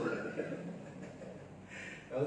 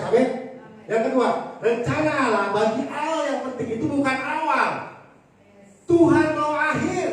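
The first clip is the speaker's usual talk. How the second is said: loud, driving sermon cadence; forceful preaching; 8 semitones higher, 7 dB louder than usual. Real, not acted. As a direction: hammer each line home to the congregation.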